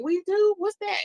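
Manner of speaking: high, baffled "we do?"; a hammed-up puzzled squeak; mock surprise. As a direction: play it innocently lost. Acting confused surprise.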